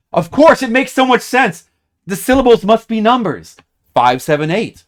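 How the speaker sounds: hammed-up eureka; eureka excitement. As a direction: burst out with a sudden breakthrough. Eureka moment solving puzzle.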